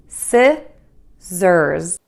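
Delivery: "Scissors" split into short si, pause, buzzed zers; syllable by syllable. Shown as a demonstration. Teacher models the whole word, "Scissors" in separated syllables. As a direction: break "Scissors" in two, si... zerz. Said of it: "start by saying this little short syllable".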